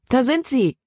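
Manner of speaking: Libby calling out high and eager; excited call. A narrator's reading. Libby spots her friends across the creek, excited call. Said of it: "rief Libby aus".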